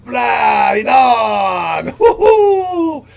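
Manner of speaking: loud whooping cheer, laughing; excited cheer. Real, not acted. Cheering after guest agrees.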